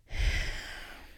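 long tired exhale; defeated breathy sigh; weary sigh. Real, not acted. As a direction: sigh it out, worn down.

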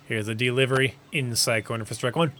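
lively baseball announcer call; sports play-by-play. Acted. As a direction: call the game like a broadcaster.

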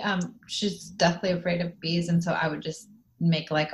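ordinary talk, nothing marked

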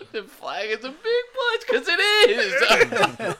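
crying with laughter, high and loud; laughing. Real, not acted. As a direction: repeat it through helpless laughter. Repeating punchline while laughing.

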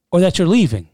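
normal talking voice

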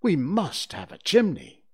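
put-on confident boy, loud. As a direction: bold boy leader, loud. Peter voiced in story.

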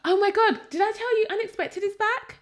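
high, loud excited gush. Real, not acted. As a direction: burst out with the news. Excited news to co-host.

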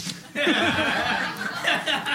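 high burst of real laughter; cracks up at his own joke. Real, not acted. Guest laughing at a side effect story, a high burst of laughter.

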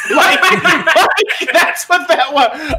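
laughing through the words; giggling while talking. Real, not acted. Laughing after the story.

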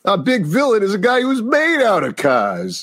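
put-on blue-collar Keystone accent. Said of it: "Keystone City is definitely like".

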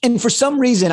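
usual speaking voice

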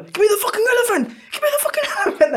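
very high, squeezed mock yell; mock English yelling. Acting out the carnival elephant demand, mock yelling. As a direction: imitate a movie hero yelling, half laughing. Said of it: "en inglés gritando".

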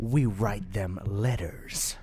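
deadpan hillbilly joke, slow. Retold or imitated. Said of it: "continues the bit".

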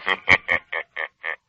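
loud mocking ghostly laugh; spooky laugh from nowhere; eerie laugh. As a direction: let the unseen laugh ring out. The Shadow reveals himself.

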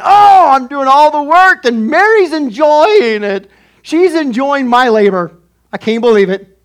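high, loud, slow complaining voice; complaining Martha. Acted. Martha complaining to Jesus that Mary won't help, hammed-up loud complaint. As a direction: play an aggrieved Martha griping. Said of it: "Martha's complaining".

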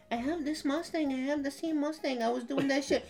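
imitated high, hushed girl's voice; soft and high, as if into an ear; whispering. Girl in the driver's ear about her Mustang, mock whisper. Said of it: "this bitch that whispered in my ear".

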